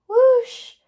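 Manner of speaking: loud high whoosh of wind. Acted. Teacher reading to kindergarten, a loud wind whoosh. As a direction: blow it out like a gust.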